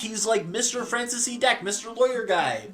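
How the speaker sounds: high-pitched put-on admirer voice; mocking awe. Someone marvelling at a famous lawyer, high mocking voice. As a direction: squeak out the name in mock reverence.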